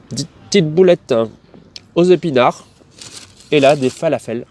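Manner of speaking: talking with a mouth full. Real, not acted. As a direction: speak through a mouthful of food. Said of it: "je parle la bouche pleine".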